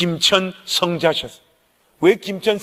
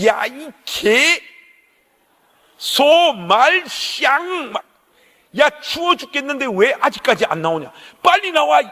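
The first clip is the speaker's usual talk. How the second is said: drawn-out insults, then pitched up to a shout; mock cursing yell; 6 semitones higher than usual. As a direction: play the rude friend yelling down the payphone. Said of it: "막 했어요".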